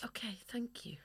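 playful stage whisper. Pretend. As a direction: whisper it back. Whispering back in mock hush.

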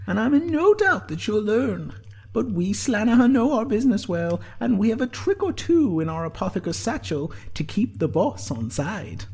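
high-pitched, confident teasing; Sinead's grinning voice. A narrator's reading. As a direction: boast with a grin, pitched high. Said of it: "grinned Sinead".

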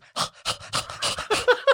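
imitating a panicking dog's breathy panting; dog's panicked panting. Dog owner mimicking his dog on a drive, panicked panting. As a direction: pant fast like a frantic dog. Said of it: "all I hear is".

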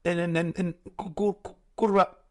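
slow stammering on "en" and "cu-", repeated; stuttering ghost. Pretend. Stuttering ghost trying to say where he died, slow stammer. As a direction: stutter it out like a ghost who can't get the words going. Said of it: "un fantasma tartaja".

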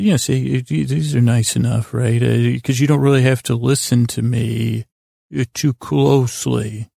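normal talking voice

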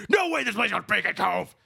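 hammed-up cry of no way; playful outraged shout at the town name; mock outraged shout. Mock outrage at a fake-sounding town.

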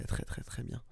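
soft, voiceless repeated praise; awed whisper. Real, not acted. Fan praising a comedy show, whispering in awe. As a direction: drop to a whisper for each word.

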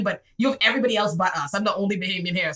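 ordinary talk

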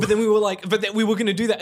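bursting with the memory, high and loud; excited. Real, not acted. Excited recollection of brainstorm.

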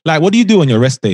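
ordinary talk

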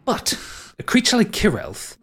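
normal talking voice

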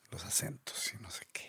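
softly whispered aside. Real, not acted. Teasing the friend about accents, whispered. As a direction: drop to a whisper.

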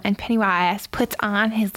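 usual speaking voice

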